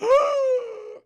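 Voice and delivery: high, loud drawn-in gasp. Retold or imitated. Caleb suddenly breathes again, huge gasp. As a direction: suck in air like someone revived.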